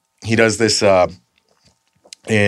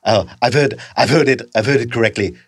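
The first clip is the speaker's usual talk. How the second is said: put-on character voice. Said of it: "then there's".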